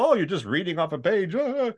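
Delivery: high-pitched mock of a know-it-all; mocking dismissive critic. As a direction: imitate someone scoffing at your job. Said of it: "So whenever people".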